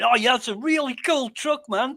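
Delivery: high-pitched eager praise; enthusiastic. Retold or imitated. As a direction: gush like an excited fan. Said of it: "I'm all enthusiastic and saying".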